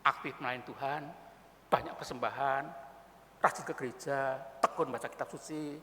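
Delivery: low, soft plea for answers; acted subdued, bewildered questioning; troubled churchgoer. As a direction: confide quietly, as if worn down by struggle. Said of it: "Bergumul-bergumul, tidak punya jawaban, datang pada pendeta".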